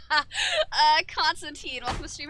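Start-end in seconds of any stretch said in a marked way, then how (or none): none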